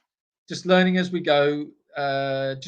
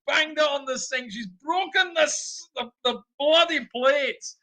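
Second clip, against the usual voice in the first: high, loud mock Scottish shouting rant; hammed-up Scottish fury over broken things; 12 semitones higher, 6 dB louder than usual. Imitating his wife ranting in Scottish about the smashed kitchen. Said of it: "she just gets very Scottish about it".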